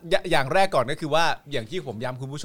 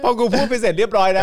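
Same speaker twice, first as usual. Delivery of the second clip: laughing; 8 semitones higher, 6 dB louder than usual. Real, not acted. Laughing hard at own story.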